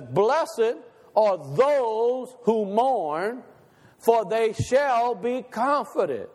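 slow, measured verse with weight on THOSE and SHALL; emphatic scripture quote. Retold or imitated. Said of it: "Jesus is emphatic about what".

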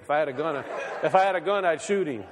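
chuckling through the words; laughing while joking. Real, not acted. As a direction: let the laugh spill into the joke. Joking about shooting the bunny.

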